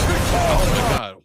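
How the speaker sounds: loud, slow startled oh my God; jolted by a sudden blast of sound; startled outburst. Real, not acted. Streamer startled by a blaring video, loud slow cry. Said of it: "does X just blare it in my ear".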